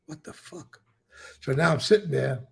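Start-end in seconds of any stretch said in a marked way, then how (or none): 0.1-0.8 whispered under the breath in disbelief; stunned whisper. Retold or imitated. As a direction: breathe it out to yourself, shaken. Walking out, stunned, to himself.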